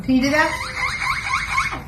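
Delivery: very high, slow drawn-out question; slow high coaxing. Real, not acted. Owner coaxing her parrot to do the car alarm, slow and high. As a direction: stretch it up high to coax the bird.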